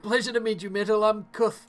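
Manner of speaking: high-voiced villager being carried; wounded husband's introduction. Wounded husband introduces himself in character.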